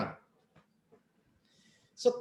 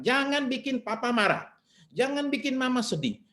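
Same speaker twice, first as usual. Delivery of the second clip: higher, mock parental warning to a child; parent scolding child; 10 semitones higher than usual. Parent warning child not to upset papa, higher mock scold. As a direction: play the scolding parent, pitched up.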